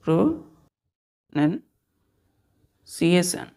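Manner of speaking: प्रोनंसिएशन stretched out very slowly, syllable by syllable; slow careful pronunciation. Shown as a demonstration. Teacher repeats the word slowly for the class. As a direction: drag प्रोनंसिएशन out so every sound is heard. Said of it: "spelling को ध्यान दीजिएगा बच्चों".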